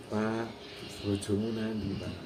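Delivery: low, softly spoken question; soft-mannered intonation. Acted. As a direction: keep it gentle and quiet. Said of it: "Walaupun itu dihalus, caranya halus". (bertanya dengan halus dan pelan)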